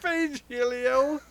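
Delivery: high slow echo; echoing pun. Retold or imitated. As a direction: stretch the pun out, half-voiced. Echoes the pun.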